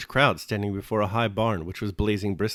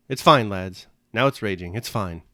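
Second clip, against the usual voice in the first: ordinary delivery, nothing marked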